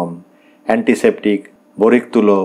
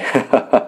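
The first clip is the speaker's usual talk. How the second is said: short acted laugh; amused laugh; 3 semitones higher than usual. A narrator's reading. Husband tickled by wife's remark, amused laugh. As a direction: burst into a mocking chuckle. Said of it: "হাসতে থাকেন".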